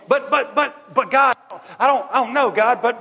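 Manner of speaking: high, loud sputtering protest; stammering panicked Moses. Acted. Moses sputtering high and loud at God, panicked. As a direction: stammer out a frantic but. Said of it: "Moses pleading to God".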